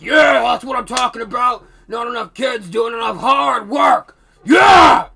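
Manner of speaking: loud, high hammed-up janitor cheer; gruff cheering janitor. Janitor praising the worker, loud mock cheer. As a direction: bellow approval like a drill sergeant.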